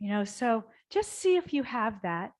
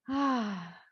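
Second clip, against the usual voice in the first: normal talking voice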